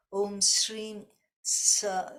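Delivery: Shreem Sa said slowly, the s drawn out at the front of the palate; slow chant of Om Shreem Sa, leaning on each sound; demo mantra sounds. Shown as a demonstration. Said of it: "the stress is more in the front of the palate".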